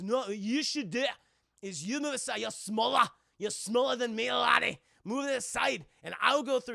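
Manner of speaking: bossy Scotsman. Retold or imitated. Scotsman tells smaller Jack to move aside, bossy. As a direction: imitate a Scot ordering someone aside. Retold Scotsman argues back.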